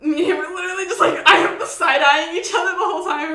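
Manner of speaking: can't stop laughing while telling it. Real, not acted. Laughing while retelling funny scene.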